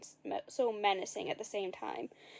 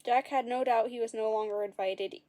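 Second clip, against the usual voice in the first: ordinary delivery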